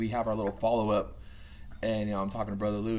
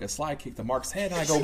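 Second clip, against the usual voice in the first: normal talking voice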